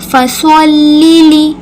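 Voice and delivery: फसल्ली slow with a full, heavy स; heavy saad. Shown as a demonstration. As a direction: say फसल्ली with a thick saad sound. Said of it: "सौद की आवाज़ निकलेगी". (फसल्ली में सौद पुर करके)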